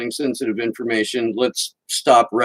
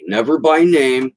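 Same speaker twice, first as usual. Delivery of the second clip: normal talking voice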